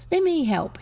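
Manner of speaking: high, eager plea; pleading. A narrator's reading. Boy begging his friend to join in, pleading. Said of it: "he pleaded".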